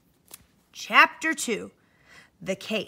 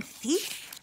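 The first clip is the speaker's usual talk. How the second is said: hushed whispered thief; 4 semitones higher, 8 dB quieter than usual. Retold or imitated. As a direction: breathe the word secretly. Teacher wonders who is yelling.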